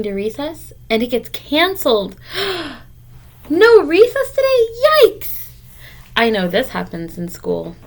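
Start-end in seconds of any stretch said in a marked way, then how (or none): 2.2-2.8 a sharp, high gasp of dismay; startled little gasp before bad news; shocked gasp. Character learns recess is canceled. 3.5-5.5 much higher and louder, shocked disbelief; a kid's loud, high-pitched shock at losing recess; dismayed surprise. Acted. Character dismayed recess is canceled.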